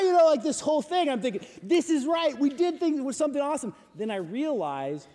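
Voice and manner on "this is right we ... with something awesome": excited. Retold or imitated. Excited inner thought.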